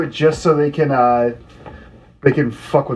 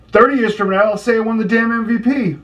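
defiant; 7 semitones higher than usual. Retold or imitated. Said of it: "do the Brian Scalabrine thing".

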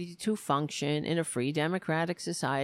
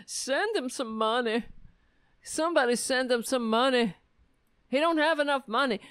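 mock plea; 5 semitones higher, faster than usual. Mocking donation plea.

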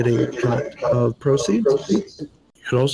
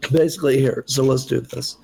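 ordinary talk

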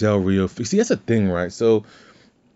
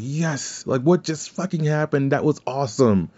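higher, thrilled outburst with hands in the air; excited cheer; 7 semitones higher than usual. Retold or imitated. Fan reacting to the punt kick on TV, excited. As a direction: cheer like the best moment just happened.

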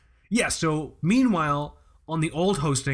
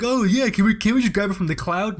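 mocking quote; 6 semitones higher, 4 dB louder than usual.

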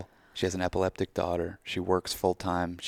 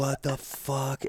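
mock outrage; 6 semitones higher, slower than usual. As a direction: stretch it out in comic exasperation. Echoing mock outrage at loss.

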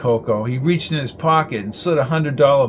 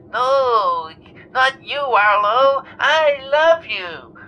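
much higher, slow cooing; doting caller; 11 semitones higher, 3 dB louder, much slower than usual. A narrator's reading. Jenny reassuring the host, cooing. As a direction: gush it slowly.

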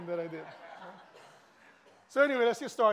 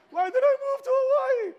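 high, loud, laughing mock outburst; mock dismay; 9 dB louder than usual. Pastor playing a shocked newcomer, laughing mock dismay. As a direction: squeal it like a shocked newcomer seeing the prices.

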